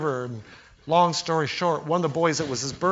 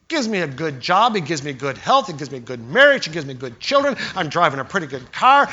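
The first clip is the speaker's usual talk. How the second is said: ordinary talk, nothing marked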